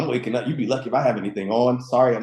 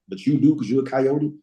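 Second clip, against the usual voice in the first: ordinary talk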